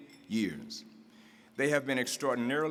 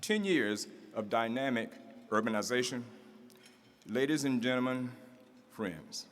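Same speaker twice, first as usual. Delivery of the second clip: normal talking voice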